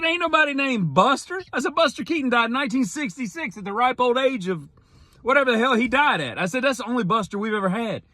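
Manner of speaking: high, far-off mocking squeal of disbelief. Son mocking the name Buster to his mom, high and incredulous. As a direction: ham up outrage at the name, high and fast at the end.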